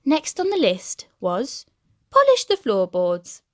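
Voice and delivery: ordinary delivery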